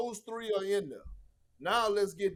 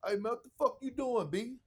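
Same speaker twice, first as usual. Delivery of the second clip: higher, quick mock KG snap at the doctor; KG's catchphrase; 5 semitones higher than usual. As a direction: lightly imitate KG calling the doctor out. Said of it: "That was his favorite".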